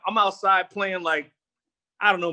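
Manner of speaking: normal talking voice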